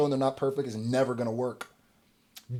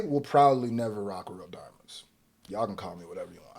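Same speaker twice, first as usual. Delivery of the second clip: lower, unvoiced hush of a confession; whisper; 8 semitones lower, 7 dB quieter than usual. Real, not acted. Admitting to viewers he'll never own real diamonds, whispered. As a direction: whisper it like a guilty secret.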